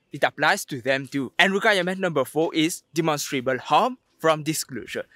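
ordinary talk

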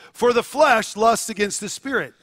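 a measured, proclaiming read of the verse; scripture reading. Acted. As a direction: read it out like a pulpit text.

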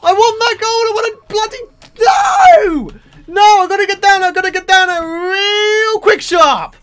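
high, loud outraged yelling; frantic shouting. Real, not acted. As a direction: yell in a panic to get your gold back. Rage at losing gold.

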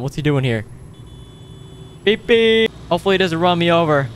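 ordinary talk, nothing marked

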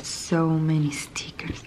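soft ASMR whisper; hushed unboxing whisper. Real, not acted. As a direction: breathe the words close to the mic.

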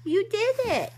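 loud, joyful praise; excited cheering. Real, not acted. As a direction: cheer her success. Baby succeeded.

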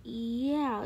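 normal talking voice

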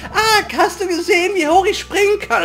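put-on high, proud kid bragging; boastful child voice. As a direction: brag like a little kid.

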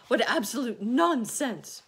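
loud, high outburst as a gloomy earthworm; exasperated, full-voiced dismissal; indignant cry. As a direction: cry out in scornful protest. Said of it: "cried the earthworm".

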